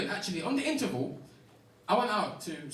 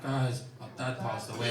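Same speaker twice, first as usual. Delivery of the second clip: mock grief; 9 semitones lower than usual. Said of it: "call into work and be like".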